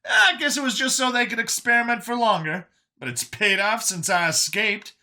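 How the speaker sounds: high, animated chatter; Brian, chatty rejuvenated survivor. Acted. Brian musing on getting his youth back, high and chatty. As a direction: rattle on high and upbeat.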